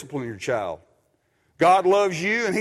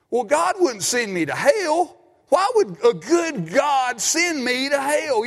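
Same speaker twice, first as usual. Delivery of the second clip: imitating a skeptic; 7 semitones higher than usual. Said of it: "Your grandkids, your neighbors".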